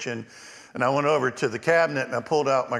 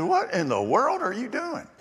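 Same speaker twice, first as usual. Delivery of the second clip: lightly voiced grandmother, pitched up high and surprised; grandmother's startled question; 11 semitones higher than usual. Grandmother finding the messy kitchen floor, pitched-up startled question. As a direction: play the grandmother catching him mid-mess.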